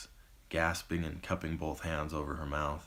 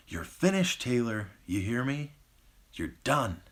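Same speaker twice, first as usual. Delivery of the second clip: higher, louder snarled threat; snarling threat; 7 semitones higher, 4 dB louder than usual. Acted. Beaten Jackson to retreating attacker, snarling threat. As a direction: spit it out like a wounded badger. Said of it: "Snarling and growling like a wounded badger".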